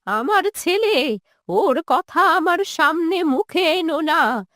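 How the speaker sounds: put-on quavering old mother, bitterly rejecting her son; wounded, resentful old woman. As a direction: refuse to hear of him, words drawn out.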